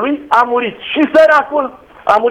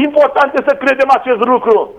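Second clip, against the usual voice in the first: usual speaking voice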